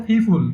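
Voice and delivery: ফিফুল with puffed "ph" for p; breathy burst on the p sounds in ফিফুল; British-style pronunciation. Shown as a demonstration. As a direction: say people as ফিফুল, aspirating the p. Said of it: "ব্রিটিশদের sound হচ্ছে ভালো".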